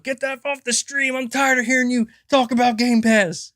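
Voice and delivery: mock his son's complaining, high and childish; imitating annoyed son. Dad mimics son yelling at him to stop streaming, high whiny kid voice. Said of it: "He's like".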